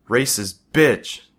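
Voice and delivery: high, loud, drawn-out shout; furious shout. Acted. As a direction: scream it as you charge. Boy charging bully in fight.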